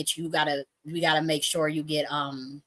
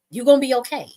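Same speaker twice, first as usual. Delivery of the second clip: imitated pissed-off man; 6 semitones higher, 6 dB louder than usual. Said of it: "'cause he was pissed".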